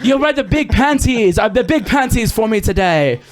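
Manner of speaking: put-on high, taunting Boston accent. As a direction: trash talk like a New Yorker. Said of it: "That's like a Boston or, like, a New York accent".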